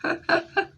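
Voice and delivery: short loud high laugh; bright giggle burst; high burst of laughter. Real, not acted. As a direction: laugh out at the memory. Laugh after shock.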